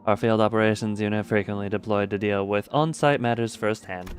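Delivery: lightly voiced calm briefing officer; character voice.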